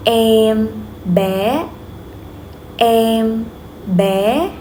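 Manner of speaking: level "Em", then "bé" gliding up; rising tone. Shown as a demonstration. Teacher models "Em bé" to repeat, flat then rising. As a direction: hold "Em" flat and lift "bé" like a question. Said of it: "raise your voice a little bit".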